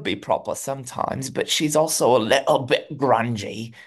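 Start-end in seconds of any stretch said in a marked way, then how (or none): 1.3-3.7 put-on British accent. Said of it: "switching between the two".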